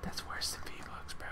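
breathy whispered repeat. Pretend. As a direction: whisper it back.

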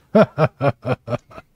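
acted chuckle of lurking dwarves; put-on staccato laugh; dwarves' laughter. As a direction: laugh like a dwarf hidden in the dark. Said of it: "dwarves laughing in the darkness".

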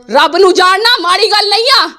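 ordinary talk, nothing marked

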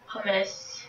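normal talking voice

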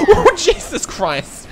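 high, loud, slow laughing shriek; scream-laugh at the scare; shrieking laughter. Real, not acted. Player shriek-laughing when the killer appears.

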